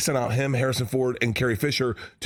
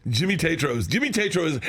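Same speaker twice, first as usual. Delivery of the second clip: ordinary talk, nothing marked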